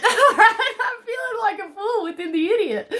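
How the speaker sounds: cracking up at her own words; laughing. Real, not acted. Reader cracking up while reading the Fool card, laughing.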